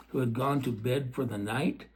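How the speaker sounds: ordinary delivery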